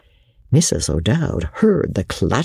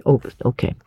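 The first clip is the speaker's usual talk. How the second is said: usual speaking voice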